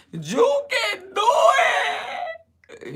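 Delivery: put-on high, slow, drawn-out cheer at a kid; loud motivating yell. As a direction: pump the kid up, stretched and loud. Reenacting cheering on his son.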